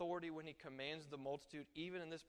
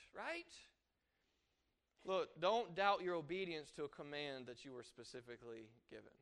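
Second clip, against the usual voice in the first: ordinary talk, nothing marked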